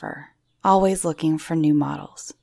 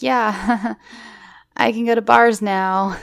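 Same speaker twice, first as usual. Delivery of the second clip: laughing along; 4 semitones higher, slower than usual. Retold or imitated. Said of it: "I'm like".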